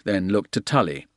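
ordinary delivery

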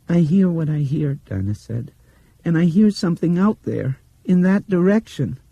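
put-on a blind girl sensing the light; dreamy little girl. A narrator's reading. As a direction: let the words drift out of a trance. Dinah voiced.

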